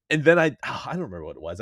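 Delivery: usual speaking voice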